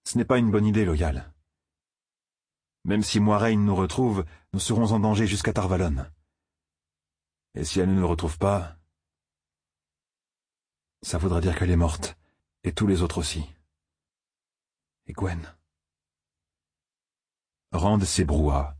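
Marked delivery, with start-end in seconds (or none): 0.1-13.4 voix de jeune homme plus aiguë, sur la défensive; Rand, un peu plus haut, méfiant et pressé. Acted. Rand répond à Loial. 15.1-15.6 demande-le presque pour soi, tout bas; inquiet, à mi-voix. Acted. Rand s'inquiète pour Gwen.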